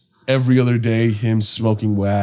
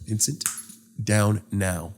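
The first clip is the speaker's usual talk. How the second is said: quiet, terse mother's order; low-volume command from an unamused mom; softly and firmly summon your kid; stern mom; 3 semitones lower, 8 dB quieter, slower than usual. Retold or imitated. Acting out Vince's stern mom.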